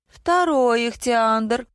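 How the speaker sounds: high, drawn-out joyful exclamation; stretched high cry of glee; delighted. A narrator's reading. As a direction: crow over the find, stretching it. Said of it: "обрадовалась тётка".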